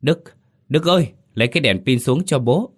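father in a blackout calls his son for a torch; calling out. Acted. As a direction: shout up the stairs in the dark. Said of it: "miệng cố hét lên".

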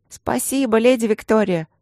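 put-on children piping their thanks to the lady; squeaky little girls. A narrator's reading. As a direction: squeak it like excited small girls. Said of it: "запищали девочки".

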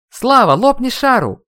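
high, loud, drawn-out yell; shouting to reach someone. A narrator's reading. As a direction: holler urgently to someone who barely hears. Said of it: "пытался я докричаться". (громкий крик, пытаясь докричаться)

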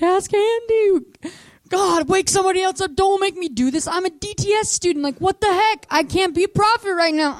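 high-pitched, exasperated pleading; comic high-voiced reluctance; whining protest. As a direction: complain to God in a hammed-up whine. Whining protest to God, acted.

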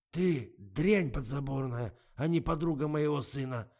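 put-on slow, loud, scornful bark of an old man. A narrator's reading. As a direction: spit the insult out slowly. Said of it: "гаркнул милый дедуся".

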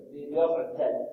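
ordinary delivery

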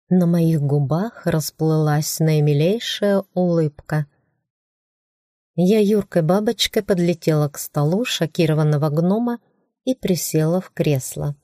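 ordinary delivery, nothing marked

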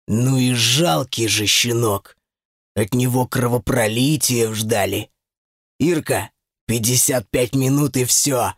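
low-pitched demon voice; demon's deep, measured gloat; triumphant demon. A narrator's reading. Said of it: "В голосе демона она расслышала торжество".

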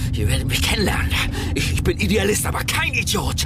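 high, loud furious yell; angry shout. Acted. Father chasing the couple, angry shout. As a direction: rage at them at full voice.

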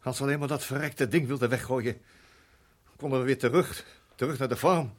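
loud and desperate, fighting for hope; anguished outburst. Acted. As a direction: burst out, barely holding together. Father bursts out to son.

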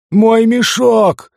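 high, loud, long drawn-out yell. A narrator's reading. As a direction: bellow it in sudden panic. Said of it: "заорал".

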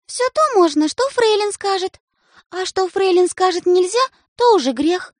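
high, loud and firm; bossy rule. A narrator's reading. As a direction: lay down the law. Sister lays down rule.

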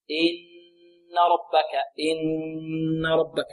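slow إن ربك with a sustained nun; full ghunnah. Shown as a demonstration. Teacher demonstrates the complete ghunnah, long hummed n in إن. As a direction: draw out the doubled n in إن as a long nasal hum, then ربك. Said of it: "هنا غنة أكمل".

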